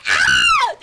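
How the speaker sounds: very high, loud yelp; panicked scream. Real, not acted. Gamer overwhelmed by the scene, panicked scream. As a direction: let out a sharp scream. Panicked outburst while playing.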